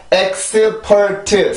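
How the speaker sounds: "엑스퍼트" slowly in four even syllables; Korean pronunciation. Shown as a demonstration. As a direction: count out "엑-스-퍼-트", each vowel sounded. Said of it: "우리나라 말은".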